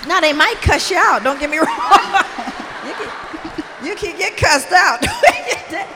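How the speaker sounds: loud, high, laughing through the words; laughing warning. Real, not acted. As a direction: crack up while warning them. Joking warning, laughing as she talks.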